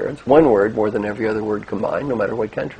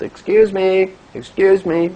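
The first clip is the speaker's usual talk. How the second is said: higher, louder imitation of calling for attention; insistent mock "excuse me"; banker interrupting; 8 semitones higher, 9 dB louder, slower than usual. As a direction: mimic a woman piping up from the audience. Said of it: "raised her hand, and she goes".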